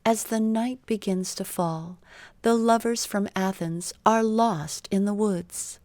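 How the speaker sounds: ordinary delivery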